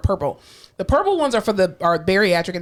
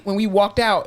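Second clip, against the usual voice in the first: normal talking voice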